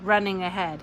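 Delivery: plain reading voice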